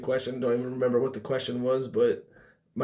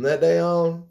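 Forrest impression; 4 semitones higher, 3 dB louder, slower than usual. Quoting Forrest narration.

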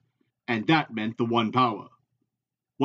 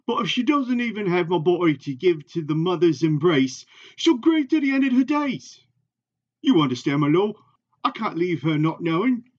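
higher, anxious voice of a worried tracker; pleading Hurin; 5 semitones higher than usual. Acted. Hurin begging Rand to get him home to his wife, pleading. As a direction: beg your lord with mounting urgency.

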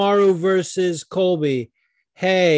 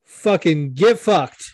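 angry rant; 7 semitones higher, 6 dB louder than usual. Real, not acted. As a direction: spit it out in disgust. Ranting at Ohio fans.

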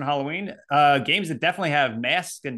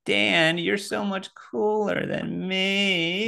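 higher, very slow admiring nerd; imitating friend; 4 semitones higher, much slower than usual. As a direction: drag it out like a starstruck kid. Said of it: "Is that what I said?".

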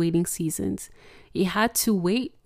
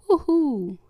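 mock cheer; 7 semitones higher, 3 dB louder than usual. Joyful exclamation when blessed.

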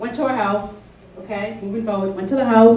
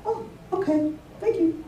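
high, soft, polite acknowledgement; very calm; 9 semitones higher, 9 dB quieter than usual. Retold or imitated. Caseworker thanking client after learning about the card, very calm. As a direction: thank her lightly and quietly, keeping composed. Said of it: "I was very calm".

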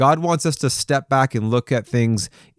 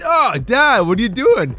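put-on high, startled cry of a hurt girl; stunned daughter; 10 semitones higher, 3 dB louder than usual. Daughter startled after her dad rams her ankle, high and louder. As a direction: squeal it like a kid just rammed by the cart. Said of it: "she was stunned and said".

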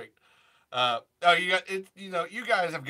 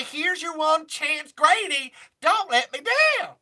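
higher, louder, slow and emphatic; 9 semitones higher, 3 dB louder, slower than usual. Retold or imitated. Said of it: "I say".

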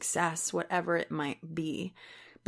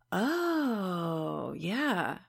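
breathing into it. Acted. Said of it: "just breathe into that for a second".

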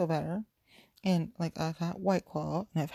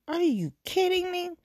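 outraged disbelief; 12 semitones higher, 5 dB louder than usual. Retold or imitated. Outraged at the other driver.

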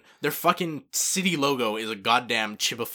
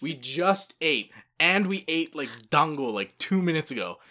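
put-on exasperated boyfriend objecting; exasperated protest; 6 semitones higher, 4 dB louder than usual. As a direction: protest in mock disbelief, leaning on the first words. Retold protest to girlfriend's craving.

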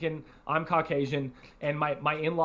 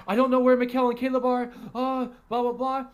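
mock panic; 8 semitones higher, 4 dB louder than usual. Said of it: "she's panicking".

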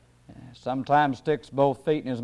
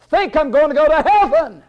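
high pulpit voice, building in force; raised, fervent sermon cadence; forceful preaching; 5 semitones higher than usual. Real, not acted. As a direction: preach it harder with each phrase.

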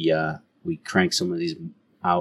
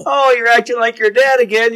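a high, hammed-up imitation of a wife's jab; lightly voiced teasing wife; mock nagging wife; 6 dB louder than usual. Mocking the wife's remark.

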